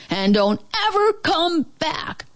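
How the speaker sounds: high-pitched parting bark; barked warning. A narrator's reading. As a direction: bark it after the fleeing thief. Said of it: "Max barked after it".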